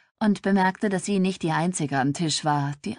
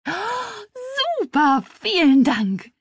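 high squeal bursting into loud thanks; overjoyed; 6 dB louder, slower than usual. A narrator's reading. Little sister thanks sister for clothes, thrilled. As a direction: gasp with delight, then exclaim loudly.

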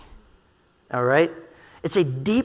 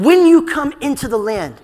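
loud, raised scripture quote; proclaiming; 6 semitones higher, 6 dB louder than usual. Retold or imitated. Preacher quoting Moses addressing Israel, proclaiming loudly. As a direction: call it out to the people, high and strong.

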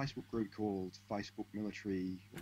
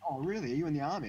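higher, louder impressed question; mock impressed; 7 semitones higher, 5 dB louder, faster than usual. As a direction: act truly impressed.

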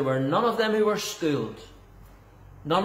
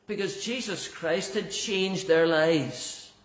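ordinary delivery

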